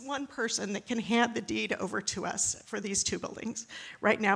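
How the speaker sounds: normal talking voice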